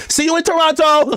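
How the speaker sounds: high mocking voice of [name]. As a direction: sing it out high and teasing. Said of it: "she on the fly with [name], like".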